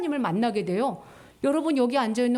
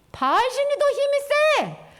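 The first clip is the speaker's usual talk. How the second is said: mocking neighbours; 11 semitones higher, 4 dB louder than usual. Neighbouring nations jeering at Israel, mocking. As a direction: taunt them with scorn. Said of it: "옆에 있는 나라 사람들이 뭐랬는 줄 알아?".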